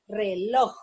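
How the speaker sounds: "Reloj" split re-LOJ, the end stronger; stress on LOJ. Shown as a demonstration. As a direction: sound out "Reloj", leaning on LOJ. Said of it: "lleva la sílaba tónica en loj".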